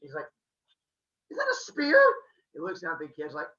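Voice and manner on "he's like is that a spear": very high loud yelp of surprise; high startled squeal; shocked cry. Acted. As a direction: jolt as it hits. Spear hits Uranus.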